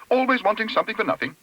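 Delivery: high, quick disapproving exclamation; exclaiming. A narrator's reading. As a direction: complain high and fast. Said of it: "exclaimed Missus Bird".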